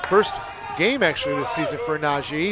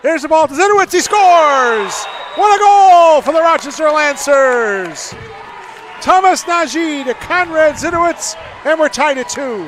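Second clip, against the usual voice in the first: high, loud, stretched goal call; long goal yell; 11 semitones higher, 10 dB louder than usual. Real, not acted. Home team scores live.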